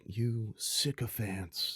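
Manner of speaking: slow lisping villain voice; Ra's al Ghul lisp. Retold or imitated. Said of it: "I like your impersonation".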